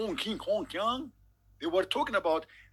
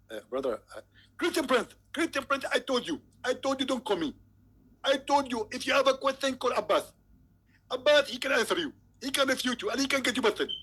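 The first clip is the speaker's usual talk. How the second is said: higher, mock-exasperated preacher voice with an accent; Zakir Naik impression; 5 semitones higher than usual. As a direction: play the annoyed preacher brushing off a caller. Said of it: "If we call Zakir Naik".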